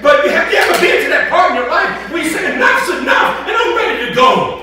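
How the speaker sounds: loud, high, building sermon cry; fiery pulpit preaching; preaching at full force. Acted. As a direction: preach it out, voice climbing to a shout.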